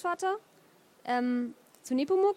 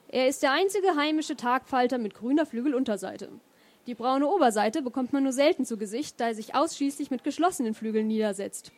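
ordinary delivery, nothing marked

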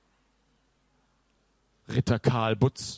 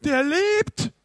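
very high, loud alarmed shriek; frightened scream; 6 dB louder than usual. A narrator's reading. Sven screams about the living mummy, very high and loud. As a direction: scream it out in panic. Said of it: "Sven schrie".

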